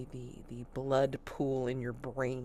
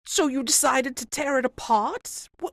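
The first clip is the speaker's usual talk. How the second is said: high, loud disbelief; can't believe this excuse; incredulous constable; 11 semitones higher, 9 dB louder than usual. Acted. Constable accuses the suspect.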